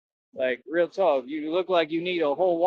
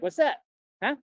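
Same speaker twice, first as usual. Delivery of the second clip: 1 taunting bouncer; 4 semitones higher than usual. Retold or imitated. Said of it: "I was talking".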